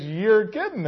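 acted amused incredulity; laughing disbelief. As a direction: act out a patient who can't believe the news. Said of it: "he laughs and says".

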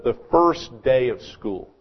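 usual speaking voice

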